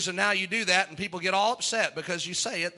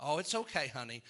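much softer, lower, patronising; soft condescending; 8 semitones lower, 11 dB quieter than usual. Retold or imitated. Scoffer talks down to the believer, soft and patronising. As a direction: pat them on the head with your voice.

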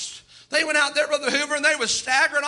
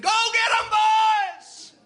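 much higher, louder sports-fan yell; fan shout; 10 semitones higher, 3 dB louder than usual. Retold or imitated. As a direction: cheer at the TV for your team.

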